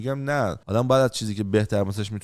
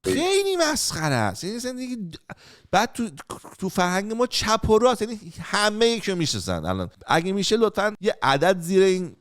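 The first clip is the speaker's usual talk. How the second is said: high mocking voice; 9 semitones higher than usual. Real, not acted. Mocking the hidden smoking habit.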